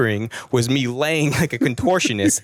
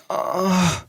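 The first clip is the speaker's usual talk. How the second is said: mock moan; 8 semitones higher than usual. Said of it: "this is my reenactment here".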